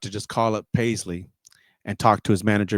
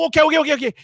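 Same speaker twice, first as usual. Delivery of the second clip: high, tumbling okays; hammed-up nervous fluster; 14 semitones higher, 3 dB louder, much faster than usual. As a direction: agree in a mock panic, pitch raised. Retold flustered reply to [name]'s manager.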